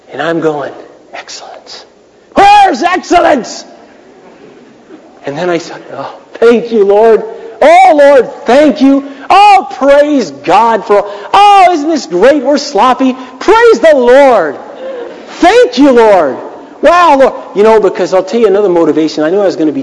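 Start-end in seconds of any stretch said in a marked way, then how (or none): 1.1-1.8 an unvoiced, hissing whisper of frustration; hissed frustration. Pastor hisses at the sloppy band rehearsal, frustrated whisper. 2.3-3.6 high, slow, frustrated yell; exasperated shout. Retold or imitated. Pastor yells at the sloppy band, exasperated high shout. 5.9-17.6 hammed-up, high, gushing thanks through gritted teeth; forced cheerful praise. Pastor forces himself to thank God for the mess, hammed-up cheerful praise.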